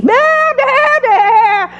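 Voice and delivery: high frantic sheep bleats; sheep bleating. Retold or imitated. Preacher imitating the sheep caught in the thicket, high frantic bleats. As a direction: bleat like a panicked trapped sheep. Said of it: "sheep would just be bleating like crazy".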